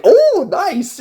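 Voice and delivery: pleased little squeal of approval; high pleased oh nice. Retold or imitated. Fan reacting to the crossover tidbit, high and delighted. So that was just like.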